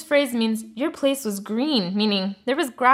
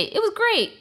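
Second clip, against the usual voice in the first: much higher-pitched, breezy reply; mock a breezy reply, pitched way up; chirpy sample answer; 13 semitones higher than usual. Mimicking too-cheerful answer to avoid.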